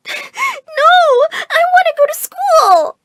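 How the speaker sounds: very high, loud, drawn-out tantrum; slow, squealing tearful protest; crying wail. A narrator's reading. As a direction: bawl it out like a distraught child. Child wailing at homeschool threat.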